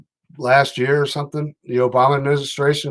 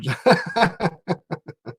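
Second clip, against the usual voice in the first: short laugh; 5 semitones higher, 3 dB quieter than usual. Real, not acted. Reacts to pot farmer comment.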